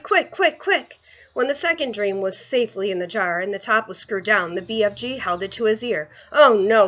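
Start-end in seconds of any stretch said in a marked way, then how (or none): 0.0-1.0 high, urgent repeated call; urgent hurry. Acted. Giant to Sophie, hurrying her urgently. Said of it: "he cried". 6.3-6.9 loud cry of dismay; a giant hearing bad news in the jar. Giant listening to the jar, crying out in dismay. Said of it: "he cried".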